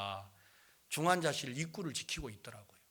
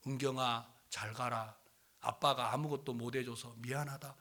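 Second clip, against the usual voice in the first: low, subdued plea; retold gentle farewell; 5 semitones lower, 6 dB quieter than usual. Preacher urging father to bid dying daughter farewell, subdued. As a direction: softly coax him to say goodbye.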